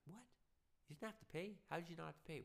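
what, how did you skip that?; incredulous. Pretend. Hearing a friend got out of paying taxes, shocked disbelief.

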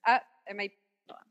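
talking around a cigar; puffing on a cigar while talking; cigar in mouth. Real, not acted. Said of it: "Sorry, I'm puffing on my cigar".

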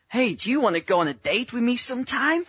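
mock the clumsy guy asking her out; awkward mock ask-out. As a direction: coach mocking a clumsy date request, hammed-up. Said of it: "Especially in that tone".

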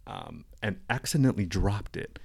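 usual speaking voice

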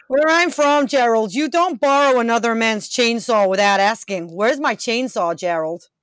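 loud, furious demand for the chainsaw; angry lumberjack shout. Acted. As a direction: bellow it like a wronged logger.

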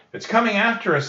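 put-on high girl's voice, alarmed; Annie, scared. A narrator's reading. Annie warning her brother, high and alarmed. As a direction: warn of the octopus coming. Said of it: "said Annie".

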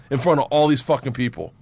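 ordinary talk, nothing marked

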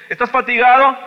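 high, loud impassioned preaching; shouting sermon, pleading with God; fiery preaching. Acted. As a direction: preach it at full force, crying out to God. Loud preaching questions to congregation.

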